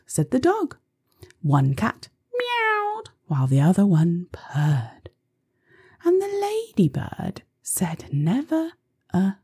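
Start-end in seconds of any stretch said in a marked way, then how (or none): 1.4-3.1 high and slow. A narrator's reading.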